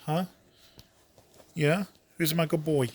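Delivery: put-on high, bright baby talk to a pet; squeaky praising a dog. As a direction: coo at the dog like a puppy. Saying goodbye to dog Kalis.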